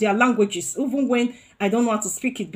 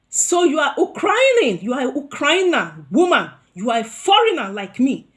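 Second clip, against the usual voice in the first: louder accusing lines; angry confrontation; 5 semitones higher, 7 dB louder than usual. Retold or imitated. Facing the Ukrainian woman on the bus, loud and indignant. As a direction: call her out, indignant. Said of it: "I got angry and I faced her".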